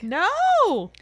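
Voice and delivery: high, loud gasp of No; genuinely shocked No; shocked cry. Real, not acted. Listener hears of the broken leg, shocked cry.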